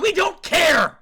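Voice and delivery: high, loud, drawn-out mock yell; imitating angry chat. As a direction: cut in shouting the demand. Acts out chat rejecting him.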